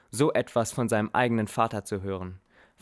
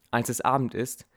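usual speaking voice

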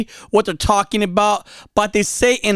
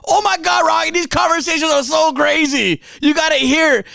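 a hint of a gushing friend, higher; excited fan voice; 6 semitones higher than usual. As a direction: lightly imitate an eager fan pitching their talk. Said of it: "Some say".

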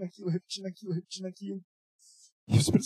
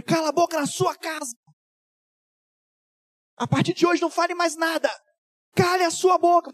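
higher, loud repeated order; forceful command; 11 semitones higher, 5 dB louder than usual. Retold or imitated. Pastor ordering the friend to stop talking at home, forceful command. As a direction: order her to keep silent, full force.

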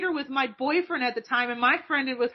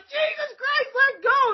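put-on high strained cry, boyfriend in pain; pained yelp; 13 semitones higher, slower than usual. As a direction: yelp like your hand is crushed. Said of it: "they're sitting there like".

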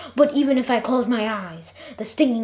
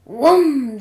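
loud, higher rocket whoosh; spaceship whoosh; 4 semitones higher, 9 dB louder than usual. Acted. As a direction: blast out the spaceship noise like it's taking off. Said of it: "The spaceship went".

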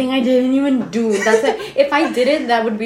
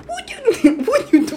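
high squeaky voice; 11 semitones higher than usual. Pretend. Catchphrase under time pressure.

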